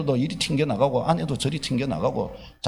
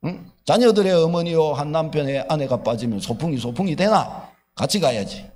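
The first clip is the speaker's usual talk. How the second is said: normal talking voice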